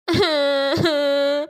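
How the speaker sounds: put-on crying sob from a little crow; a sob to win sympathy; fake crying wail. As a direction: pretend wailing. Crow child cries at door.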